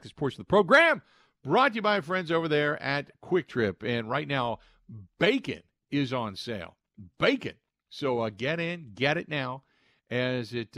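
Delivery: ad read. Acted. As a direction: sell it like a live radio ad. Host reads a sponsor ad.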